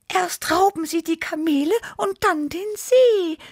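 high-pitched, alarmed cat voice; squeaky outraged alarm; frightened Tibi. A narrator's reading. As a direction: wail it high, like a scared kitten. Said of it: "miaute Tibi erschrocken".

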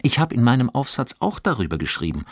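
fast, eager chatter; eager rush. A narrator's reading. Johannes telling Fabian about his essay, fast and eager. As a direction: hurry on, full of eagerness. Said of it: "fuhr Johannes eifrig fort".

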